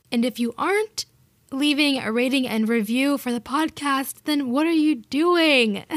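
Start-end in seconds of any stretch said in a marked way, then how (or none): 4.2-5.8 higher, louder, slow teasing demand; jokey mock outrage, drawn out; mock scolding. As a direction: playfully scold the listeners who haven't reviewed. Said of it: "No, just kidding".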